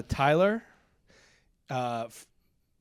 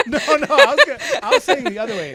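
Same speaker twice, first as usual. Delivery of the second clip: laughing hard while talking; breaking up with laughter; 11 semitones higher, 11 dB louder than usual. Real, not acted. Laughing through explanation.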